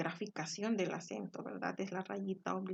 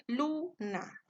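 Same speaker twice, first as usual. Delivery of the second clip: "Luna" split in two, high stressed "lu"; 6 semitones higher than usual. Shown as a demonstration. Teacher showing the stressed syllable, "Luna" with raised "lu". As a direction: lift the "lu" of "Luna", then "na" apart. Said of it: "se encuentra en la penúltima".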